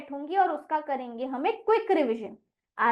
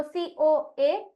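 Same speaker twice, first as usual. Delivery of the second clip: slow spelling; much slower than usual. Shown as a demonstration. Teacher spelling for pupils, very slow. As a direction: spell COA out slowly. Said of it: "बीच में ए भी दिया गया है".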